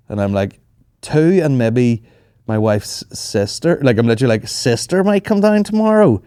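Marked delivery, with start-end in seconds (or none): 1.0-3.8 slow, drawn-out Donegal-host-wary hedging; slow hedging to the Airbnb host about guest numbers; sheepish understatement. Retold or imitated. 4.5-6.2 much higher, slow, butter-wouldn't-melt tone; slow high innocent line to the host about the sister. Retold or imitated. Sneaking extra guests past host.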